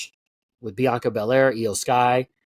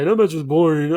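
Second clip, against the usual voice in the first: a nagging complainer's voice, louder; whiny complaining fan; 3 semitones higher, 5 dB louder than usual. Retold or imitated. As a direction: mimic a bored whiny fan. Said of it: "I know some people say".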